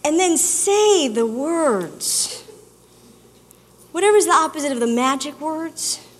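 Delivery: ordinary delivery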